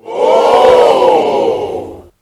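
breathless fake impressed oh; hollow polite gasp; phony oh. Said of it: "in such a phony way".

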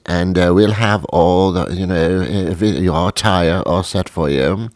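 fussy British press secretary; British accent. Acted. Press secretary offers to book a flight, British accent. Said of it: "had a British accent".

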